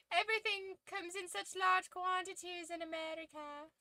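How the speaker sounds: high, hammed-up fake British accent; weird mock accent. Imitating UK people marveling at American sizes, weird fake accent. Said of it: "I don't know what that accent was. I was just doing a weird one".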